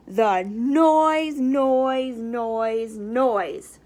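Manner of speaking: a hint of the Grinch grumbling "noise"; mock grumbling. Grinch grumbling about the Whos, fading mild complaint.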